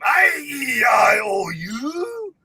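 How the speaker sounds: high loud mock Irish vowel shout.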